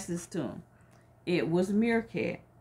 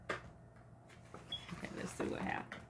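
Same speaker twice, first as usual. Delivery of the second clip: much softer, whispered to herself; whispered aside; 17 dB quieter than usual. Real, not acted. Teacher whispering to herself as she reads. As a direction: murmur it while looking for the place. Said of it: "whispered aside while reading".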